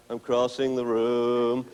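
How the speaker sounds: very slow, bored narration of leaving; dull slow walk-off. Shown as a demonstration. Showing the owner the dull way to leave the puppy, very slow. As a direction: drag it out with no life at all. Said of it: "slowly walk away from them like".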